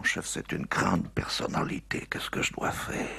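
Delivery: whispered, slow and secretive; confidential whisper. Acted. Policeman whispering to his chief about the big personality. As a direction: hush it so nobody hears.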